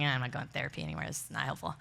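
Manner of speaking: low, soft, fast muttered brush-off; dismissive grumble. Retold or imitated. Alicia refusing video therapy with a quick low grumble. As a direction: wave it away under your breath.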